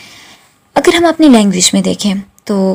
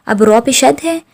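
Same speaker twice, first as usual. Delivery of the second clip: normal talking voice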